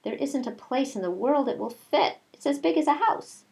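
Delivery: climbing to a high pitched wail; exasperated Nellie. Acted.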